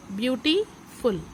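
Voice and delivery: "Beautiful" in two parts, beauty then फुल; teacher breaking "beautiful" apart for learners; split word. Shown as a demonstration. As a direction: pause before the फुल of "beautiful". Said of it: "three syllable word".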